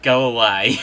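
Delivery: mock brush-off. Quoting the meme line.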